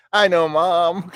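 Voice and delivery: high, loud, drawn-out protest; imitating a son fed up with mom; exasperated son. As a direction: stretch it out like a nagged teenager. Son answers mother's scolding, retold.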